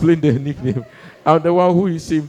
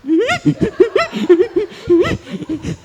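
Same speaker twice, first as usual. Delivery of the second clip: high choked laugh; 4 dB louder than usual. Retold or imitated. Holding back tears.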